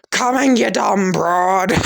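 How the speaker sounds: loud high rude yell; yelling back. Acted. Son shouts down the stairs at his mother, loud and rude.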